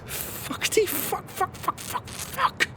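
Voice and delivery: hushed, high panicked cursing under breath; frantic swearing. Real, not acted. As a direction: curse in despair. Realizes they were robbed.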